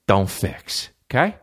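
normal talking voice

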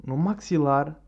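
ordinary delivery

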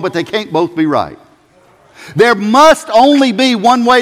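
full-force sermon delivery; fiery preaching. Acted. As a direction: drive the point home from the pulpit.

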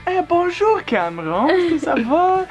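casual French greeting in a put-on French lilt; a hint of a breezy French teacher; mock French teacher. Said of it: "she looked at me like".

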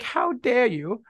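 put-on high, drawn-out old British lady. Podcaster mocking an outdated phrase, old British lady voice. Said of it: "I'm an old British lady".

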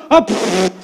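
blown raspberry bursts; comic splattering mouth noise; imitated explosive bowel noise. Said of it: "all of a sudden she goes".

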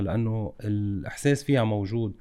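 ordinary delivery

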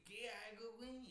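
mock lost, helpless plea. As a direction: play the stranded guy begging for help. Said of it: "buscando ayuda".

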